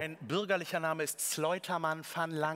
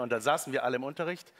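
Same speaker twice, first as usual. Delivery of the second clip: ordinary talk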